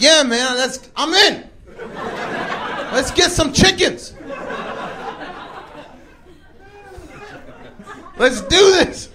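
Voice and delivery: high-pitched, all-in enthusiasm; acting out a pal who is totally on board; excited agreement. Acts out Blanco's excited agreement.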